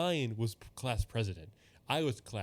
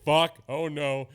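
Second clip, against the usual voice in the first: loud, drawn-out mock dread; mock dismay; 4 semitones higher, 9 dB louder, much slower than usual. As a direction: react with slow, louder pretend alarm. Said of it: "And it's like".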